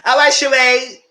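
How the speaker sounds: teasing sendoff. Real, not acted. Loud teasing sendoff to Sheree.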